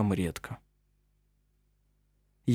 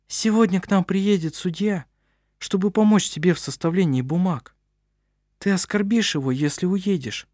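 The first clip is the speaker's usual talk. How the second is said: higher, anxious wife voice; woman's voice; 6 semitones higher than usual. A narrator's reading. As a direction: plead gently as a worried wife. Narrator voices Berit.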